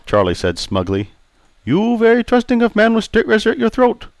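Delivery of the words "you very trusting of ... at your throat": put-on raised, accented old barber voice; polite Chinese-accented barber greeting. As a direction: voice Pei the barber, courteous. Pei warns.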